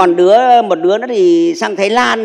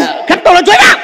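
joking shout; 10 semitones higher, 5 dB louder, faster than usual. Acted. Joking shout while laughing.

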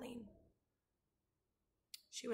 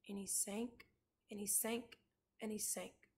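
much softer, trailing repetitions; hushed, sinking narration; 9 dB quieter than usual. A narrator's reading. Narrator follows the rabbit sinking in the sea, hushed. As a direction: let each phrase drop away softly.

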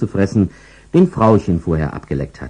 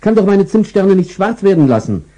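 higher, hurried and fretting; flustered owner; 11 semitones higher, 4 dB louder than usual. Acted. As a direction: rush to save the cookies. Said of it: "schrie Frauchen".

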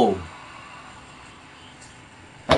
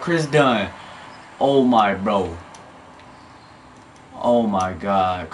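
ordinary talk, nothing marked

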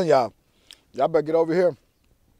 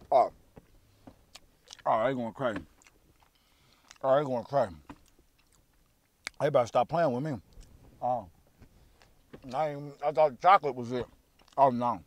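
talking while eating pastry; speaking between bites; mouth full, chewing; 2 semitones lower than usual. Real, not acted.

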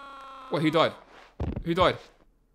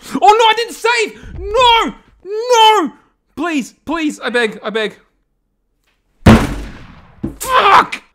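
high, loud cries of despair; anguished yell; 12 dB louder, much slower than usual. Real, not acted. Gamer dies after forgetting to save, wailing in despair. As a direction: wail at the loss, begging the game.

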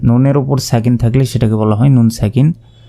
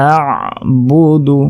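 very slow chanted word with ayn; slow tilawat; Quran recitation; 3 semitones higher, much slower than usual. Shown as a demonstration. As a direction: draw out আ'বুদু melodically. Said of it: "Hamza আইন জবর আ".

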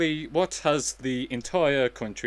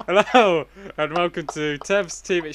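show host; 6 semitones higher, 8 dB louder than usual. Acted. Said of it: "you're ruining the intro".

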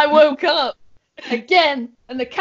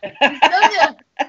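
slow, louder, laughing through it; laughing punchline; 4 semitones higher, 4 dB louder, much slower than usual. Retold or imitated. Repeating the cat joke to friends, laughing slowly. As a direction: savour the punchline while cracking up.

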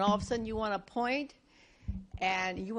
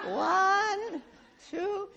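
high, bright counting One and two; counting out points; 10 semitones higher than usual. Shown as a demonstration. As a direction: tick off each point clearly. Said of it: "I have three points".

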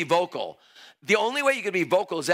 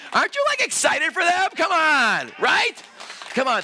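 high, loud hype; excited rallying; 10 semitones higher, 4 dB louder, slower than usual. Real, not acted. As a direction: whip the crowd into cheering. Rallies crowd to cheer.

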